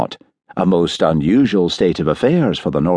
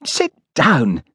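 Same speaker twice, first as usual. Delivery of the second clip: mildly startled, higher pitch; 9 semitones higher than usual. A narrator's reading. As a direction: react with surprise to his touchiness.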